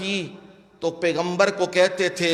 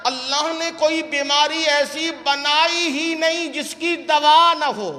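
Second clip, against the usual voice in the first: emphatic preaching; 8 semitones higher than usual. Acted. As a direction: proclaim the master's saying on a raised pitch. Preacher quotes his spiritual guide.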